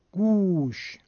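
گوش with a long, drawn 'oo'; long vav. Shown as a demonstration. Teacher contrasting vav with damma, long 'oo' in گوش. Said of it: "این تفاوت در بین واو و ضمه".

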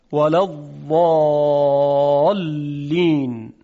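ولا الضالین slow, ض from the tongue's edge, not a d; drawn-out الضالین with the side-tongue ض; classical tajwid Dad. Shown as a demonstration. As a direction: recite the old tajwid ض carefully. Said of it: "شما هم تمرین بکنید".

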